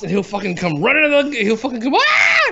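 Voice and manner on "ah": very high mock scream; imitated scream. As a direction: imitate a kid shrieking in fright. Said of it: "He'll come screaming".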